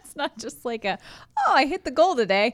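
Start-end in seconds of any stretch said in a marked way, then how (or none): 1.5-2.5 high, loud, mock-cheerful boast. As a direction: cheer like it's easy.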